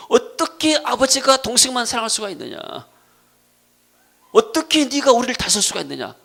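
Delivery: high, loud indignant demand; confrontational. Acted. Joseph's brothers protesting their father's favoritism, loud and confrontational. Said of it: "이렇게 따지고 들은 거예요".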